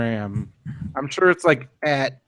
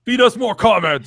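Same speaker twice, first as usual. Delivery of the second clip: higher-pitched wrestler demand; mock wrestling promo, pitched up; playful, lifted wrestler voice; Ryback wrestling voice; 4 semitones higher than usual. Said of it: "like your wrestling voice".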